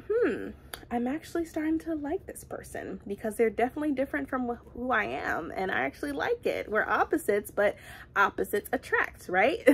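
high, soft musing voice, lightly played; Ekon falling for her. Ekon muses on falling for the spontaneous Kofi, soft and high. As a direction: wonder aloud like someone newly smitten. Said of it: "that's how they started to, like".

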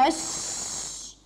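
الش- whispered and drawn out slowly; very slow voiceless الش-; slow isolated onset. Shown as a demonstration. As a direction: stretch الش- in an unvoiced breath. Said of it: "تتصل اللام بالحرف الأول".

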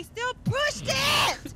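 high, drawn-out yell; slow, rising shouted complaint; exasperated shout. Pretend. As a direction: shout it in outrage. Outraged account of a scene.

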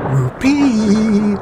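high, loud gleeful yell; young dragons cheer at the plan, yelling high and loud; yelled whoopee. A narrator's reading. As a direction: whoop it out with glee. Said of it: "yelled the young dragons".

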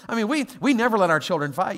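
normal talking voice

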